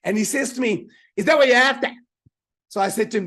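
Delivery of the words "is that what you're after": loud, higher broad Aussie question; broad Australian accent. Retold or imitated. Waiter serving the salad, loud broad Australian accent. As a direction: boom it out like an Australian bogan. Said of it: "in this very broad Australian accent".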